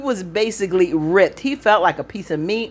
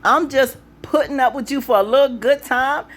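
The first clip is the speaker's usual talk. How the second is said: higher, cheering the character on; hyped up; 7 semitones higher than usual. Real, not acted. Fan talking to Andy on screen, hyped.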